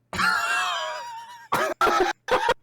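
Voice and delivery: high crying laugh shriek. Real, not acted. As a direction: squeal with laughter. Reacting to the Miku picture.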